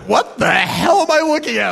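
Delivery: loud, high-pitched mock bafflement; baffled outburst. Help desk guy staring at a full-screen prank, baffled. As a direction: act out someone utterly baffled, voice shooting up.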